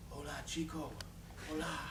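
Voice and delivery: soft, gentle call from above; a woman's soft voice mistaken for God's. Retold or imitated. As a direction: call down kindly and softly, like a heavenly voice. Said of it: "but a soft, gentle voice".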